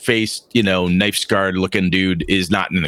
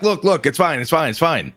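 higher, hurried insistence; nervous reassurance; 7 semitones higher than usual. Acted. Innkeeper stirring the soup, nervously reassuring. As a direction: cover up, slightly panicked.

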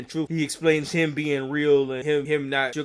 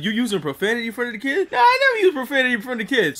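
social worker and Bernie; 8 semitones higher, faster than usual. Retold or imitated. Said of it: "He was like".

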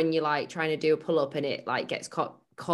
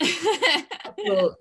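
ironic casual; 12 semitones higher, 4 dB louder than usual. Acted. Joking about her gym injuries.

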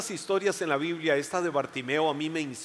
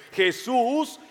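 higher, loud, drawn-out call; crying out; 7 semitones higher, 7 dB louder, much slower than usual. Acted. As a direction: stretch the cry out slowly. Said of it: "Ese fue el grito de Bartimeo".

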